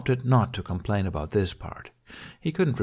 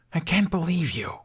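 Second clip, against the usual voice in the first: much higher, hissed in disbelief; 9 semitones higher than usual. A narrator's reading. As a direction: hiss it out, pitched up and indignant. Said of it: "he hissed".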